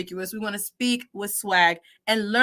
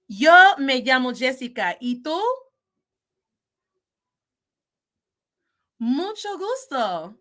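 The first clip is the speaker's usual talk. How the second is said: model answers; 2 semitones higher, 4 dB louder than usual. Shown as a demonstration. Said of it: "I will respond in orange".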